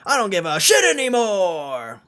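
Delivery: high loud yell; defiant yell. Real, not acted. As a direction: shout it out carelessly. Defiant outburst.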